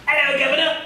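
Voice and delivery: high, hammed-up British accent; put-on British voice, high and silly; mock British accent. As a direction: imitate a cheesy British greeting, pitched well up. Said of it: "obliged to do their British accent".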